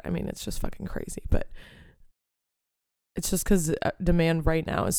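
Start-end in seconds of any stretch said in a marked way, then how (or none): 0.0-1.2 whisper. Real, not acted. Aside about the ticket price.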